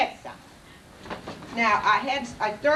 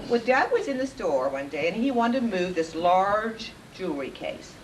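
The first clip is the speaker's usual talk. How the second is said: ordinary talk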